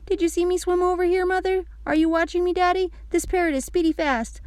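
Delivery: put-on high child calling out; high-pitched, eager kid voice; excited little girl. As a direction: show off to your parents. Child calls to parents while swimming.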